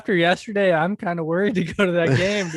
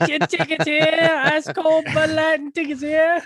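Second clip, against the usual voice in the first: pushy ticket tout; 10 semitones higher than usual. Pretend. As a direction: hawk it like a stadium seller. Hawking tickets and beer.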